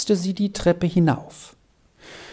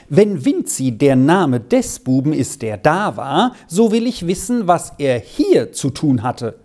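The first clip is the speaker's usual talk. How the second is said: ordinary delivery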